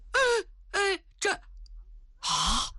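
high frightened stammering into a gasp; terrified stammer. A narrator's reading. The general stammers in fright before the empress. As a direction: stutter in terror. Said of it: "唐古德差点吓死".

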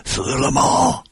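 very high, strained cry of disbelief; hoarse, desperate cry. A narrator's reading. Father hearing his daughter is dead, desperate strained cry. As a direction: cry it out with all your strength, without much volume. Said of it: "我声嘶力竭".

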